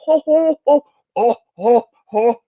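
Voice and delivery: high hammy mocking chuckle; falsetto laugh. Laughs in the parody voice.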